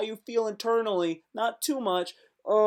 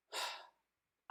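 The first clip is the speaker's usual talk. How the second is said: heavy sigh; 18 dB quieter than usual. Acted.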